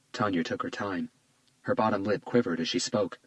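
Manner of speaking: plain reading voice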